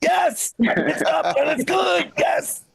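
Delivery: high, whooping sports-fan celebration; cheering guy. Retold or imitated. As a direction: mimic guys cheering a score, drawn out. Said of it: "The guy's going".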